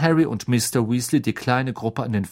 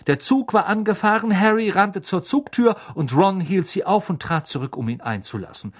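ordinary delivery

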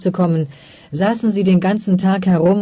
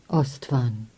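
much softer, low and tender; motherly tone; 5 semitones lower, 8 dB quieter than usual. Acted. Mother softly calling her son, motherly tone. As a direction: say his name like a caring mother. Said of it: "Dieser mütterliche Ton in ihrer Stimme".

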